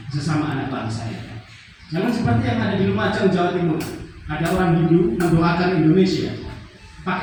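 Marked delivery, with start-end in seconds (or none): none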